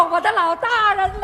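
high, put-on stage voice; theatrical wheedling greeting. Suspect's stagey greeting to the judge.

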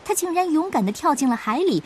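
plain reading voice

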